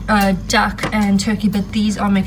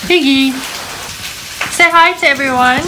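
coaxing pet voice; 7 semitones higher, 4 dB louder than usual. Real, not acted. Coaxing the pig for camera.

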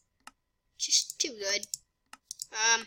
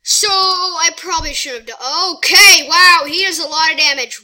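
higher, much louder outburst; loud surprise; 4 semitones higher, 10 dB louder than usual. Real, not acted. Gamer seeing heavy damage land, loud startled outburst. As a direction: break off into a startled shout.

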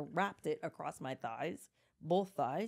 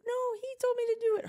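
put-on high, fast, loud toddler voice; imitated panicky little girl; little child protesting; 6 dB louder, much faster than usual. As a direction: blurt it like a small kid shifting blame. Retold toddler blaming her brother.

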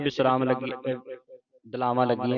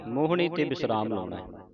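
ordinary talk

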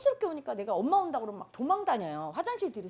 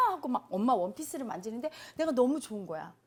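ordinary talk, nothing marked

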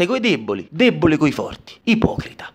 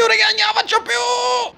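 Ned collapsing in a high-pitched shout; wailing give-up. Acted. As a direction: let it crack into a howl. Ned breaking down, louder.

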